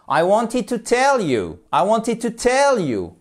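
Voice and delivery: high swoop peaking on "tell", falling on "you"; English intonation. Shown as a demonstration. Teacher modelling a non-flat sentence, high English intonation. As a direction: sing out "I wanted to tell you" high, then descend. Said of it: "il y a aussi une intonation à respecter".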